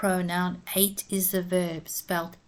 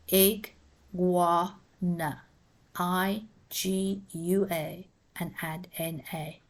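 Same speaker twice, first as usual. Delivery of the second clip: "iguana" stretched out very slowly, every syllable drawn out; slow, spelled out; slower than usual. Shown as a demonstration. Teacher slowly spelling out "iguana" for pupils. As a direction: draw "iguana" out slowly, sound by sound. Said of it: "Look at the spelling".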